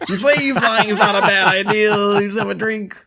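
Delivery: high, comic doctor impression; Doctor Huxtable. Doctor talks a nervous flier round, high impersonation. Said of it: "something from Doctor Huxtable".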